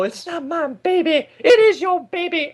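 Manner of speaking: high, slow hammed-up guest voice; mock talk show guest. Mocking a Maury paternity reveal, hammed-up high voice. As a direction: squeal the denial in a mock falsetto.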